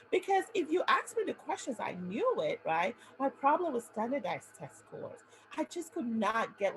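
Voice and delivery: ordinary delivery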